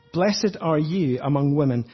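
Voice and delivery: loud, slow proclamation of blessing; bold exclamation. Retold or imitated. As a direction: declare it boldly, drawing out each word. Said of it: "exclaims in a loud voice, with certain boldness".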